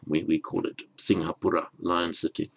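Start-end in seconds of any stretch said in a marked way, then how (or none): none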